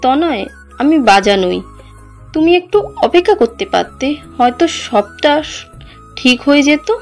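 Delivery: wounded resignation behind a smile; pained smiling forgiveness. Acted. Betrayed wife forgives cousin and leaves husband, pained resignation. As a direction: absolve her gently while hurting inside. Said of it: "হাসি মুখে উত্তর দেয়".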